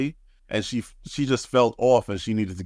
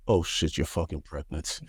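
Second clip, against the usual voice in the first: softer, dropping to a mutter; quiet joking guess to wife; hushed mock shock; 5 semitones lower, 5 dB quieter than usual. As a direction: say it low and deadpan.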